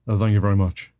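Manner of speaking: put-on rock-and-roll star voice. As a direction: answer as the King would. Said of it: "I find myself talking like him".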